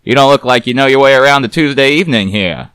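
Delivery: loud, fast wisecracking private eye; gruff detective voice. A narrator's reading. Narrator voices Garbo teasing the nervous woman, wry.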